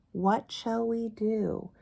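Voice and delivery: ordinary talk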